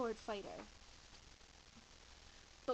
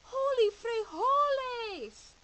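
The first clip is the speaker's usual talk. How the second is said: much higher, much louder, slow yell; cry of alarm; 11 semitones higher, 13 dB louder, much slower than usual. Acted. Skipito thrusting his sword at the bandit, loud cry. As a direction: yell it in shock. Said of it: "cried Skipito".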